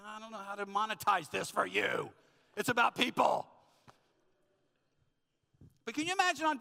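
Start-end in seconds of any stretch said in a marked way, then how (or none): 0.1-3.5 mock-indignant retort, building louder; hammed-up defensive outburst; exasperated protest. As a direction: protest to the wife, ending in a loud burst. Acted protest to nagging wife.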